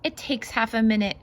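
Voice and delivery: lively, bouncy read-aloud voice; singsong picture book reading; reading to children. Acted. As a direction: read it out like a storybook for little ones.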